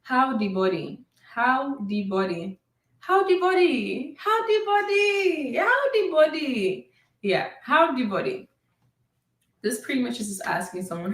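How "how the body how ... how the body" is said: teacher repeating How the body; Krio phrase. Shown as a demonstration. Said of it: "To say "How are you?" is".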